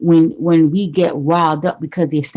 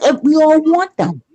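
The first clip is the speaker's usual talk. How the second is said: mock smug guy; 9 semitones higher than usual. Mocking smug men's thoughts.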